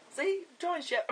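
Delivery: jumps very high and cracks; voice going funny. Real, not acted. Vlogger shows shouting makes the voice go funny, high squeak. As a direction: let the voice shoot up out of control. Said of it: "My voice goes funny".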